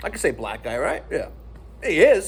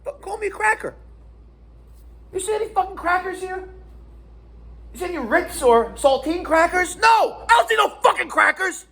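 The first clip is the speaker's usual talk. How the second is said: higher, outraged venting with a shouted no; indignant rant; 10 semitones higher than usual. Real, not acted. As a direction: vent the outrage at the camera. Indignant rant at being called cracker.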